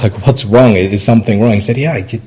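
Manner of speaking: ordinary talk, nothing marked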